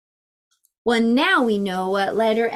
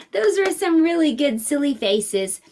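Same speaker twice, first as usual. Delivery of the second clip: ordinary delivery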